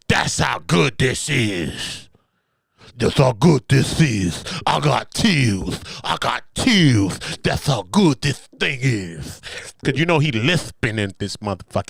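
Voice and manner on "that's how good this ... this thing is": slow, savoring mock voice; slow mock relish. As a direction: drag it out like savoring a moment. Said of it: "He said at that point".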